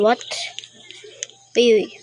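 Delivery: ordinary talk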